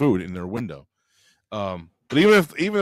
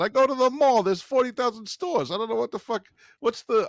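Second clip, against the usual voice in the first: retold bit in a higher voice; Joe; 9 semitones higher than usual. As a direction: pitch it up for the quoted bit. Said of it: "Joe did a whole bit about".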